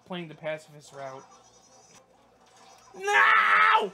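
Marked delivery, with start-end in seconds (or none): 3.0-3.9 full-throated high shout of "no"; frustrated shout. Real, not acted. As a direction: yell it out in dismay. Pacifist run goes wrong.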